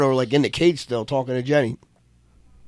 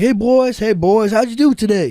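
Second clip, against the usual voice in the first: high, louder imitation of a friend's greeting; put-on Corey voice; 8 semitones higher, 5 dB louder than usual. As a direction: call out cheerily as an imitated buddy. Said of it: "King of accents over here".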